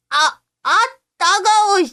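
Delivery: put-on very high child's yelp; stinging pain. Boy stung by aftershave, yelping in pain.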